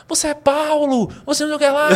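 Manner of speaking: high, loud imitated shouts from the crowd; crowd shouting. Mimicking the crowd yelling at him on stage, high and loud. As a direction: yell it like an excited audience member. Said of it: "O pessoal começou a gritar".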